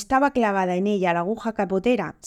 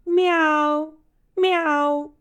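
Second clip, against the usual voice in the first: high, drawn-out imitated meow; cat meow; 8 semitones higher than usual. A narrator's reading. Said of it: "oyeron una voz que dijo". (maullido de gato, agudo y sostenido)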